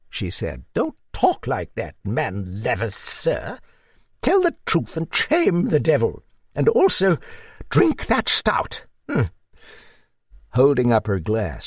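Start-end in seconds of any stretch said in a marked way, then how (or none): 0.7-9.3 put-on high-pitched hearty old dame; a raised, hearty voice for the old lady; old Duchess.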